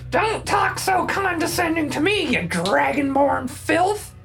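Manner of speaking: high, sneering goblin snarl. Acted. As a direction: spit it out like a nasty goblin.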